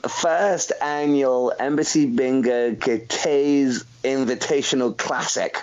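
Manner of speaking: slow, deliberate over the long name; careful slow reading. Shown as a demonstration. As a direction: spell the title out slowly. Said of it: "let me try and say the whole name".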